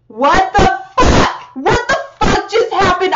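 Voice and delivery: loud, high, drawn-out disbelief; stunned disbelief. Real, not acted. Fan stunned by the video ending, loud disbelief. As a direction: let the shock spill out slowly.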